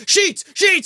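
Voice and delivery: high, loud yelled-out answer; imitated yelling. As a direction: yell it like a game show contestant. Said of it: "He's, like, yelling".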